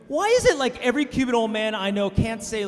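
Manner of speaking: ordinary delivery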